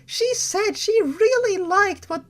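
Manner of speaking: put-on high, sweet child voice; little girl voice. Small girl tells her uncle about mommy, childlike. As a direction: play an innocent little girl.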